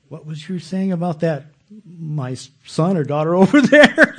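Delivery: teasing rebuke with a laugh; mock rebuke. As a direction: playfully scold yourself as the Lord would. The Lord's rebuke, voiced.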